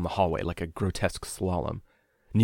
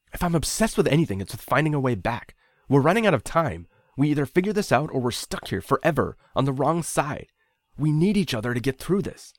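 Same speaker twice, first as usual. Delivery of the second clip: angry insistence; 8 semitones higher, 5 dB louder than usual. A narrator's reading. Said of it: "I was angry".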